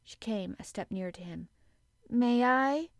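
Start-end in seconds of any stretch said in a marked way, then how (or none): 2.1-2.9 put-on high, tremulous child; hopeful, nervous little question. A narrator's reading. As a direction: ask timidly, pitched up and louder. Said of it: "she said tremulously".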